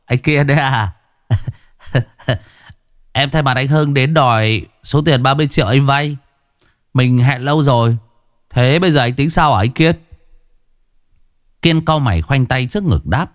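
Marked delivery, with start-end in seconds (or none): 0.0-10.0 smirking. Acted. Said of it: "cười nhếch mép".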